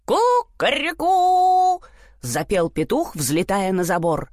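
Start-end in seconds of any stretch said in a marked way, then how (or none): 0.0-1.8 high slow crowing; rooster crow. A narrator's reading. Rooster crows.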